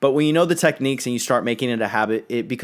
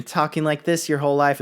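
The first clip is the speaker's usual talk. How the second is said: put-on higher-pitched voice; higher everyday voice; 3 semitones higher than usual. Shown as a demonstration. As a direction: jump up into a higher register. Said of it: "if you don't naturally have a deep toned voice".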